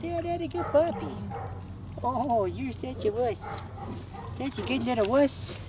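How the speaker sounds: high cooing pet voice. Real, not acted. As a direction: coo at her like a puppy.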